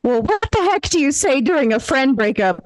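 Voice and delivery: ordinary delivery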